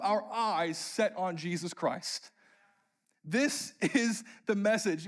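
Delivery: high, impassioned sermon delivery; emphatic preaching. Real, not acted. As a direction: drive the message home to the congregation. Preaches the message.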